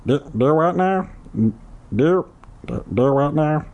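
dim cartoon kid voice; Meatwad voice. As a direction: stammer it like Meatwad. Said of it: "that was Meatwad".

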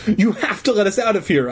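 high, insistent plea; pressing to be let out. Acted. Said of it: "I insisted".

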